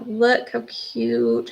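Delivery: cooing. Real, not acted. Cooing over photo.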